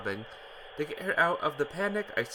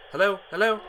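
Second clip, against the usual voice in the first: calling hello; 6 semitones higher, 8 dB louder than usual. Acted. Ricky answers noisy phone call.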